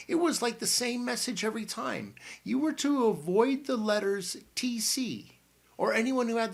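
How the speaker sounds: an excited aunt bursting into the room; Aunt Matilda. A narrator's reading. Aunt Matilda bursting in on the boys, high and bustling.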